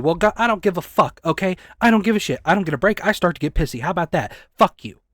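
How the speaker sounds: furious venting at lazy coworkers; furious outburst. Real, not acted. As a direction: snap back in real anger. Furious reply to smokers' excuse.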